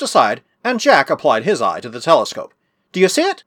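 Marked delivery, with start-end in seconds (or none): 2.9-3.4 high-pitched eager question. A narrator's reading. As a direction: ask it high, itching to know. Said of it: "asked Mark eagerly".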